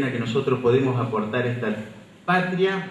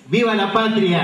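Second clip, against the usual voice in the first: booming balcony speech; 7 semitones higher, 4 dB louder than usual. Real, not acted. Rally cry ending the speech.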